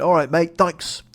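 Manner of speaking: loud, high mock British "All right, mate"; breezy hammed-up English accent; chirpy imitation of a British passenger. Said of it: "he just went".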